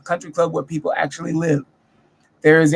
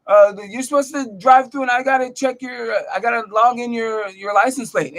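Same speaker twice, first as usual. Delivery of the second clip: mock guard yelling; 6 semitones higher than usual. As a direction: ham up the angry guard barking at you. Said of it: "starts yelling at me like".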